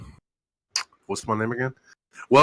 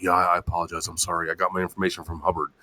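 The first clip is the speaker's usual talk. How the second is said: meek mock apology; 6 semitones lower, 8 dB quieter, faster than usual. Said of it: "he's like".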